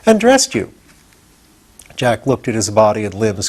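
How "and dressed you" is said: proudly. A narrator's reading. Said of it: "said Tip proudly".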